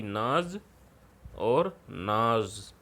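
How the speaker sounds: नाज with j, then नाज with z, drawn out slowly; teacher contrasting two नाज; naj vs naz. Shown as a demonstration. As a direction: stretch the second नाज with a z sound. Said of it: "एक का मतलब है अनाज और दूसरे का मतलब है गौरव".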